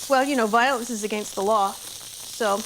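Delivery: usual speaking voice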